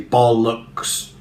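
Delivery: slow "bollocks" with equal weight on both syllables; "bollocks" with two level beats; evenly stressed. Shown as a demonstration. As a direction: say "bollocks" as BOL-LUCKS, neither syllable stronger. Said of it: "evenly distribute the stresses".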